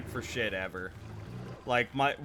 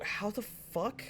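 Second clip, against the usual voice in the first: higher, softer baffled quote; mock bafflement; 7 semitones higher, 5 dB quieter, slower than usual. Retelling a glance at the typo, mock-baffled. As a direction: imitate quiet disbelief, pitch lifted. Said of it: "And I go".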